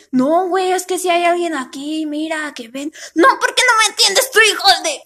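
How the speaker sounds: hammed-up shop quarrel; quarrelling clerks. Vlogger imitating two clerks arguing over who serves, angry.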